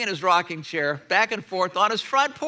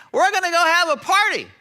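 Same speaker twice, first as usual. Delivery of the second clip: higher, louder, overjoyed welcome; joyful father; 9 semitones higher, 7 dB louder than usual. Retold or imitated. Father to returning son, joyful and loud. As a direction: burst out with a father's relief.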